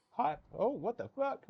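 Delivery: very high-pitched outburst; startled disbelief. Real, not acted. Caster seeing the wrong player get MVP, startled disbelief. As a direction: squeal it in disbelief.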